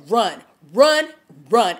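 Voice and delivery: loud urgent run run run; urgent warning. Retold or imitated. Warning Olivia to flee, loud and urgent. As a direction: push the warning out hard. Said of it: "it's like".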